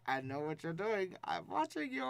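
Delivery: hammy menacing Putin impression, watching you; a lightly voiced bad Russian accent; terrible Putin accent. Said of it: "That is a terrible accent".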